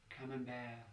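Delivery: soft, half-whispered last word; faint whisper. Acted. Injured man whispers before passing out, softly. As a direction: murmur it softly, as if about to pass out. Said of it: "I whispered before passing out".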